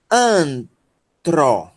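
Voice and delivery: Antro split into "An", pause, "tro"; deliberate pause inside Antro; syllable-by-syllable demo. Shown as a demonstration. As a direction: sound out Antro in two separate syllables. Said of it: "vai ser pronunciado como".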